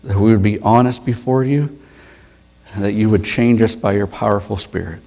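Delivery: hushed, reverent opening prayer; bow your head and pray to the Father. Acted. Opening prayer before sermon.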